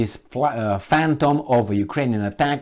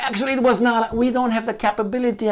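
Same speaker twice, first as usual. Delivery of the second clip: high, timid mocking voice; the commentator mocks Ukraine's denial in a high timid voice; mock meek denial; 10 semitones higher than usual. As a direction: mimic a feeble official excuse. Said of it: "Is that how you say it?".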